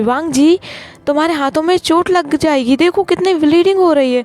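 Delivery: high-pitched, pleading worry; acted alarm, pitched well up; alarmed concern. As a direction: voice a character fretting over a bleeding hand. Voiced character alarmed at bleeding hand.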